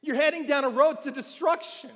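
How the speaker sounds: shouted alarm from the pulpit; yelling stop. Shown as a demonstration. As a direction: cry out a warning to the lost. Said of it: "needs us to start yelling".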